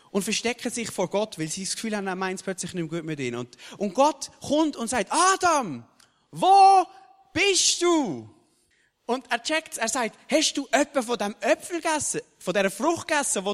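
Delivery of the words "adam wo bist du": loud, high call across the garden; God calling out. Acted. God calling for the hiding Adam, a loud searching call. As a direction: call out for him with a rising, carrying shout.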